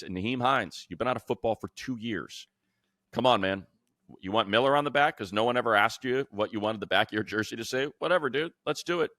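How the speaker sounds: usual speaking voice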